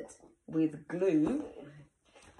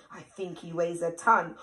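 ordinary delivery

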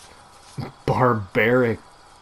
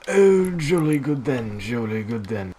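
hammed English butler, jolly; delighted butler approving his plans; butler accent. Gamer voicing a pleased English butler to his teammate. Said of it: "butler accent".

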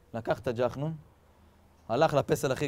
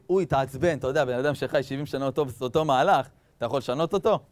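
usual speaking voice

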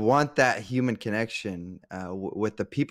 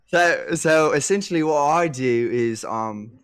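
mock British commentator accent; cheesy British accent; 2 semitones higher than usual. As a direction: play a cheeky Brit, mostly half-hearted. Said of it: "I forgot about my British accent".

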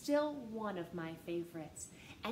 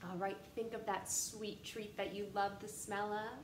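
usual speaking voice

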